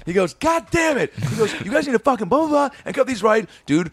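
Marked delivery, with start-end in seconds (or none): 0.3-1.1 very high mock-angry cursing; retold exasperated outburst. Retold boss exploding. 1.6-3.5 very high mock-angry cursing; retold exasperated outburst. Retold boss scolding staff.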